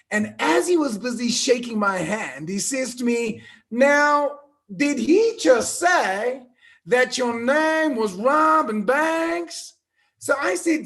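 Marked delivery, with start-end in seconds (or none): 3.7-9.7 slow, high Southern drawl; bad southern drawl. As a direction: imitate an Arkansas man, drawing words out. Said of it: "Excuse my bad southern drawl".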